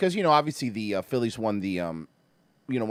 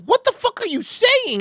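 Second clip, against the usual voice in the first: high, slow, drawn-out WTF; outraged disbelief; 4 dB louder than usual. Retold or imitated. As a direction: stretch it out in shocked outrage. Retold reply to racist remark.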